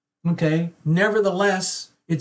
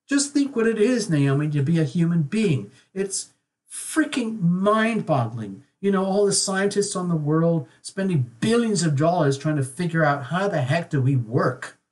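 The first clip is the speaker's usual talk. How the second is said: ordinary talk, nothing marked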